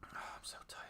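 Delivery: whispered, worn out and drained; tired whisper. Real, not acted. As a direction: breathe it out as if too tired to speak. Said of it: "that's the fourth time I've said that".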